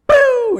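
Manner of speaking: high, loud mouth-made bang; imitated gunshot. Tank commander getting rid of protester, imitated gunshot. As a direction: fire off a vocal shot.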